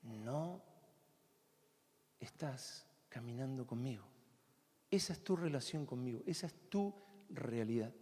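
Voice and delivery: God's quiet answer. Acted. Preacher voices God's soft reply to the struggling believer in a low hush. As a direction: speak it gently as if God answers. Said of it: "la respuesta es bien sencilla".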